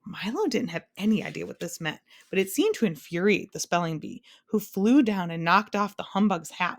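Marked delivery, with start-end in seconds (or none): none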